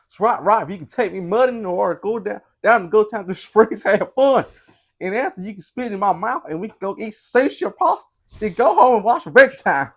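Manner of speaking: loud, high-pitched country holler; country profile voice. Acted. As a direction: shout it out like a rowdy country caricature. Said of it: "I read this in the wrong voice".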